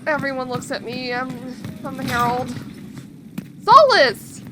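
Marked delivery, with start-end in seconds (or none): 3.6-4.4 loud shout of the name; loud stunned yell; shouted call. Real, not acted. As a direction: yell after him in outrage. Calling out to character.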